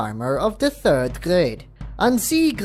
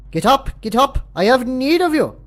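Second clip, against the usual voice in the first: raised, higher voice of holy summons; pious command; 12 semitones higher, 7 dB louder than usual. A narrator's reading. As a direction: summon them as a divine messenger. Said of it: "His voice was filled with piety and grace".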